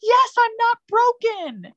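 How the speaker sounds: high, slow joyful mock yell; retold joyful scream. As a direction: shout the relief out high. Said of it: "literally screamed at the top of my lungs".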